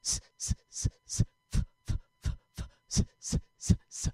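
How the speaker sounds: punchy breath-pulse hissing; loud hisses. Shown as a demonstration. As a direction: push the air out in strong hisses. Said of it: "So we're gonna go".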